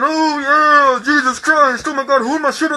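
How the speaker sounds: high ecstatic mock preacher; hammed-up holy-roller frenzy; mocking snake handler. Mocking the snake handlers at a Kentucky service, high ecstatic.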